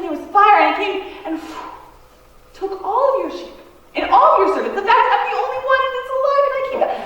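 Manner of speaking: high, loud, nervous laughing rush; a servant bursting in with disaster, panicked and laughing; panicked messenger. Acted. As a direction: blurt the bad news with frantic giggles.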